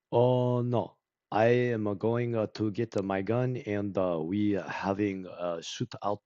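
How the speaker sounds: put-on slow, halting English in a heavy Japanese accent; imitated Japanese accent. Movie fan imitating actors who can't speak English, heavy Japanese accent. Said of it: "Japanese people trying to act it out who can't speak any English".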